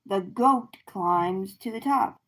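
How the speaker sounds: ordinary delivery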